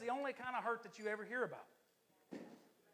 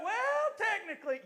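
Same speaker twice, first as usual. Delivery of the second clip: mock defensive excuse; 12 semitones higher, 7 dB louder than usual. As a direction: stretch WELL into a hammed-up excuse. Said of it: "If you have to go".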